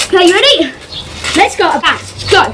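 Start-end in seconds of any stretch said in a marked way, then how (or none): none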